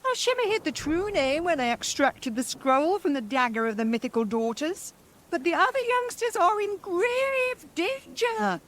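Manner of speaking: high crone voice; high witch voice warning of danger; hag voice. Acted. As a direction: speak as an ancient witch. Second sister hag voice.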